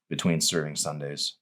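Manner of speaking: ordinary delivery, nothing marked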